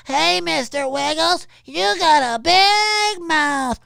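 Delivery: high, slow mock kiddie voice; drawn-out squeaky taunt; Pee-wee voice. Streamer imitates Pee-wee taunting, high and slow. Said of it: "the whole".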